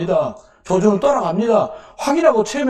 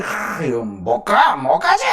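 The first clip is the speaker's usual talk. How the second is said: voicing the old man; 6 semitones lower, slower than usual. Old man voiced in story.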